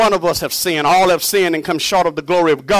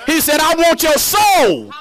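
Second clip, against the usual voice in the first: emphatic preaching; 8 semitones higher than usual. Real, not acted. Preacher giving Jesus' claim on souls, high emphatic proclamation. Climax of repeated sermon refrain.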